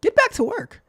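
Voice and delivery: high, quick nagging order; high-pitched annoying nag. Acted. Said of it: "my annoying voice comes saying".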